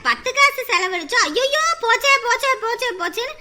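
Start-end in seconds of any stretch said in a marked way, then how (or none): 1.3-3.3 imitating a stingy person's mock lament; mocking lament over spent money; mock dirge wail. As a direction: ham up a wailing "ஐயோ போச்சே". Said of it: "ஒப்பாரி வைக்கிற ஜென்மங்க".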